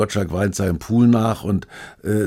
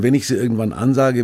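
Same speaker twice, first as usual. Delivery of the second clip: ordinary talk, nothing marked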